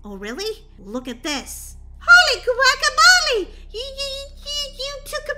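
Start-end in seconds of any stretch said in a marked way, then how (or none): 2.0-5.4 much higher, louder astonished cry; amazed shout. Acted. Daisy sees the zombie video, loud amazed cry. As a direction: explode with surprise.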